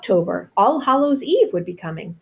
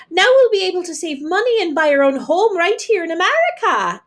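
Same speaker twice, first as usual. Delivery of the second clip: high, louder joyful call; excited call; 8 semitones higher, 5 dB louder than usual. A narrator's reading. Mother announcing the dream home, high excited call. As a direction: call it out while dancing. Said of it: "Annie called out as she danced".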